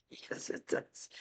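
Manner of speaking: breathy sarcastic whisper; slow, just air, no voice. Real, not acted. Reader whispers a dry aside to the viewers: slow whisper.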